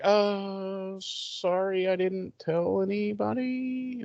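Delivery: soft, high, drawn-out little voice; mock sheepish apology. Host voicing Buffy apologizing after the reveal, sheepish. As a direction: squeak out a guilty confession, hammed-up.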